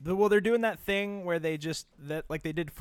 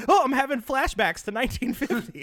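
mom voice; 8 semitones higher, 5 dB louder than usual. Retold or imitated. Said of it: "She's gonna be like".

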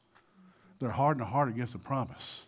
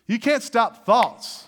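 loud, raised preacher's call; preaching at full force; 8 semitones higher, 8 dB louder than usual. Acted. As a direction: drive it home to the church.

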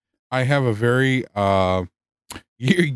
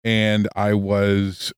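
slow, deliberate mock innocence; slow drawn out; 4 semitones lower, slower than usual. Real, not acted. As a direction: stretch each word out slowly.

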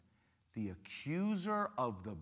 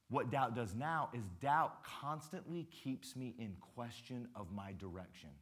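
normal talking voice

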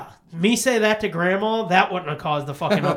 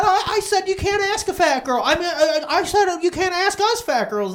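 high, loud laughing protest; defensive mom; 11 semitones higher, 6 dB louder than usual. Retold or imitated. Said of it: "Kept going on and on".

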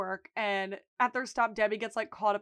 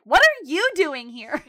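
loud, higher-pitched character impression; Chucky; 5 semitones higher, 10 dB louder than usual. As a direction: mimic a startled kid's blurted question. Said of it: "That was a perfect impression".

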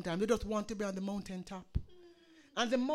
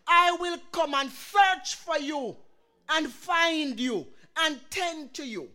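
preaching; 3 semitones higher, 6 dB louder than usual. Acted. Preacher to his congregation, swelling into full preaching. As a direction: preach it to the congregation with growing force.

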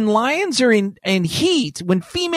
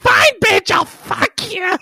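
high, slow, resigned yell; defeated lion; 10 semitones higher, slower than usual. Pretend. Tired lion giving in to lioness with a whiny high groan. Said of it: "sad look on his lion face going".

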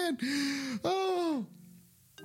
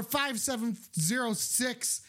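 ordinary talk

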